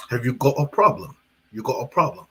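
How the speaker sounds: a hint of a British accent, taunting; lightly British taunt. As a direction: mimic a British fighter's trash talk. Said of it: "talking trash to him in his British voice".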